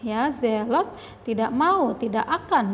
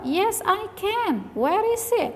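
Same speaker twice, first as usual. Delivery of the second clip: high voiced Cika; 7 semitones higher than usual. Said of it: "Cika menjawab".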